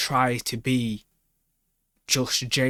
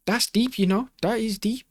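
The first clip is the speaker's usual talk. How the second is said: impressed; 10 semitones higher, 3 dB louder than usual. Real, not acted. Reacting with awe to Mel's line.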